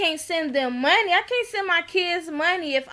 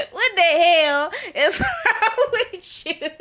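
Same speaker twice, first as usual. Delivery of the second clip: slow, laughing disbelief; laughing through it; 4 semitones higher, 3 dB louder, slower than usual. Real, not acted. Vlogger laughing at the couple, slow and amused. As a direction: let the laugh break the line. Laughing while quoting herself.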